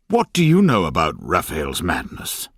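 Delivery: plain reading voice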